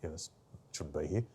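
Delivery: hushed, dismissive whisper; a visitor sneering under breath; whispered critic. Retold or imitated. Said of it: "or someone goes".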